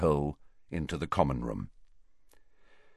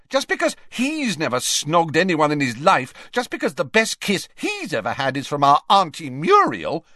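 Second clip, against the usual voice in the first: high-pitched scornful taunt; mock him hotly, voice raised; flaring up; 8 semitones higher, 4 dB louder than usual. Ginny taunting her brother in front of Harry, hotly. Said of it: "flaring up at Harry".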